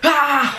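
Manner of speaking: loud, high exasperated "ah"; worked-up cry. Real, not acted. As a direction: let out a burst of pent-up energy. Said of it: "really worked up right now".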